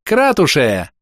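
high, drawn-up shout to rally; rallying cry. Acted. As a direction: call everyone onward eagerly. Rallying cry repeated by characters.

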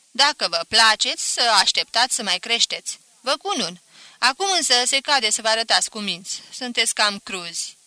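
voiced master advising the young pair; fatherly master. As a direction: master telling the children to wait.

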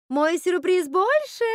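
high, slow, loud drawn-out call; sing-song shout. A narrator's reading. Witch topping Rapunzel's surprise, sing-song shout. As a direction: shout it up in a stretched lilt. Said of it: "крикнула Готель".

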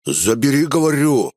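insist, pitch climbing on the last word; a firmer, impatient order; firm demand. A narrator's reading. Burnt bandit ordering his partner, demanding firmly. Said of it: "твёрже потребовал жжёный".

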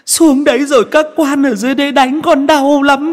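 very high falsetto, crying plea; begging ghost. A narrator's reading. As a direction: ghost clinging to his legs, begging. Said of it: "chồm lấy chân cậu Tẩy mà lạy".

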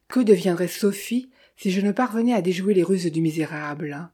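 ordinary delivery, nothing marked

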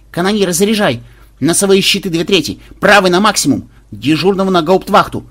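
loud, high commanding shout; shouted battle orders. Acted. Officer orders gunners to stations under attack, shouting. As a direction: fire off urgent orders to the crew.

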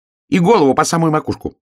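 high, loud, rapid hedgehog cry; excited shout. A narrator's reading. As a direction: shout it fast in a small high voice. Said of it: "крикнул ёжик".

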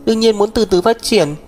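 put-on young woman's voice. Sister telling her brother to stay out, stubborn girl voice. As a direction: speak up for herself, stubborn.

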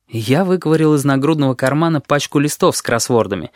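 plain reading voice

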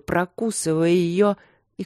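ordinary delivery

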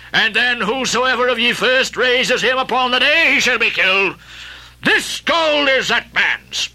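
solemn vow. Acted. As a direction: swear it grandly before the crew. Ahab vows over the doubloon.

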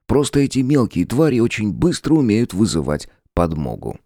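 usual speaking voice

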